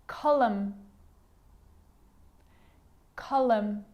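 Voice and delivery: "Column" ending on M, with no N; careful isolated "Column", closing on the lips; silent N. Shown as a demonstration. Teacher giving silent N examples, careful repeated model. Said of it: "it actually is silent when it's next to".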